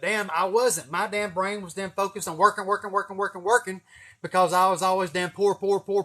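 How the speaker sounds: loud, rising insistence, each working hammered; loud rant. Real, not acted. Ranting to viewers about his work ethic, loud. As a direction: hammer the word working over and over.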